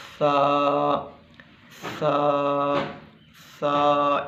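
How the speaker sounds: "सा" soft, tongue between the teeth; gently, no force on "सा". Shown as a demonstration. Teacher demonstrating soft सा to students. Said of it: "इंतहाई नरमी से पढ़ना है".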